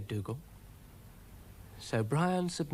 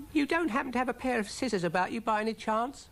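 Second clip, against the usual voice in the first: high, fast little snail voice; high-pitched rattled cartoon snail; snail character; 12 semitones higher, faster than usual. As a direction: ask quickly in a high, squeaky voice. Brian asks for scissors.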